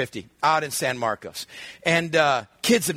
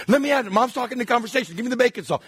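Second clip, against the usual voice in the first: put-on higher, pushy little-boy whine; demanding kid; 6 semitones higher, much faster than usual. Nine-year-old brother demanding bacon salt, whiny. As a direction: grab for it, pleading.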